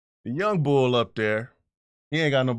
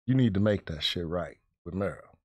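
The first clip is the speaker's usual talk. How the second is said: low serious advice; 8 semitones lower, 6 dB quieter than usual. Retold or imitated. Barber's serious advice, voiced low.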